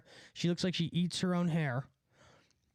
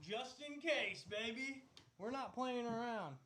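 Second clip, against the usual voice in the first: soft, high, reassuring words from a distance; soothing the dog; 5 semitones higher, 12 dB quieter than usual. Real, not acted.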